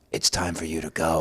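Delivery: deep, quiet threat; hulking giant guy. Acted. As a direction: drop way down like a huge man. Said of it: "it was Shrek".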